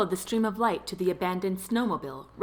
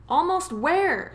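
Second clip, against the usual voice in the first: high, slow questioning call; calling back; 9 semitones higher, 3 dB louder, slower than usual. A narrator's reading. Daphne calls back to the driver, calling out. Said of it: "she called back to him".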